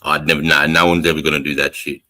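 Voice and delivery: normal talking voice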